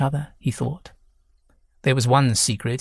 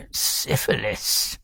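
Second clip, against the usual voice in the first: wheezed whisper; 3 semitones lower, 4 dB quieter, much slower than usual. A narrator's reading. Old husband blurts diagnosis laughing.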